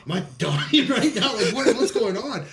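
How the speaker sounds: high, laughing mock worry; a high soldier asking if he is dying, laughing; laughing panic. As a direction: ask through laughter as if scared. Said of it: "I'm like".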